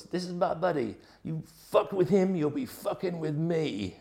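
high-pitched imitation bellow, held back in volume; mock bellow. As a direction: act out a protective buddy's bellow without really shouting. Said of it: "bellowed at the top of his voice".

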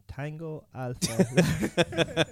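slow, laughing put-on Boards Mill accent; mock Boards Mill accent. Reading the fake police snippet to his co-host in a thick Boards Mill accent. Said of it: "do it in your proper accent now".

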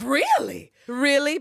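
high, loud doubled "Really?"; echoing it back in theatrical disbelief; mock disbelief. Eve reacting to Fernanda's confession, mock shocked. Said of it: "And Eva's like".

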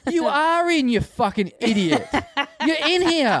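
very high, incredulous insistence; acted-out exasperation, pitch jumping up; exasperated retort. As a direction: spell it out to someone who isn't listening. Acting out exasperated reply to punter.

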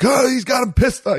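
high shouted mock screech; retold screech. Husband imitating Gabe's screech at the snake, high shout. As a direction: squeal it out like a startled man. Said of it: "you hear him kinda screech a little bit".

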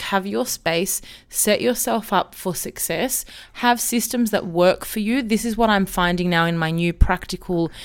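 ordinary delivery

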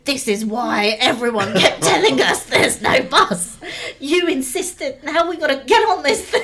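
imitating a fed-up friend's rant; mock angry shouting. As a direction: scold loudly, as a comic retelling. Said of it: "shouting and screaming at me constantly".

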